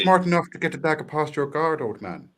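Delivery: retry the line as the Irishman; Irish accent try. Retold or imitated. Friend retrying the quote as the Irishman, in a mock Irish lilt. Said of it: "would it be more like".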